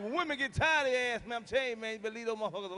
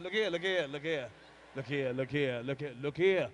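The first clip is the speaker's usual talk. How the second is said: deep low pleading, getting lower; fading plea; 8 semitones lower than usual. Retold or imitated. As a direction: sink the voice lower with each plea. Husband pleading, voice dropping.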